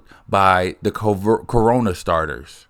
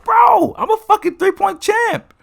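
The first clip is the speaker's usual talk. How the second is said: indignant high yell; 5 dB louder than usual. Retold or imitated. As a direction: shoot way up into an outraged high-pitched yell. Retold self insisting, voice jumps.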